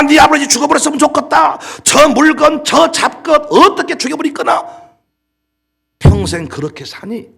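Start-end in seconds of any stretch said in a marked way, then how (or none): 0.0-4.9 loud, high spiteful cursing; hammed-up angry mother's curse; mock bitter mother. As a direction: spit it out like a bitter wife.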